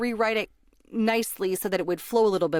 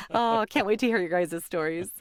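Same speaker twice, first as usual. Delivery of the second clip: ordinary talk, nothing marked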